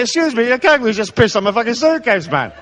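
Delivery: traveller's indignant protest at the kangaroo; mock indignant complaint. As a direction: sputter it like an offended traveller.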